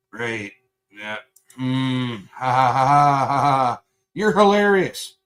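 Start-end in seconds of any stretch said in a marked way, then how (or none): 2.3-3.8 spelled-out sarcastic ha ha ha; fake laugh. Real, not acted. 4.1-5.2 high, slow sarcastic jab. Real, not acted.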